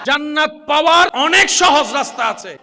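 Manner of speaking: high, loud preaching burst. Acted. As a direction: hit the crowd with it.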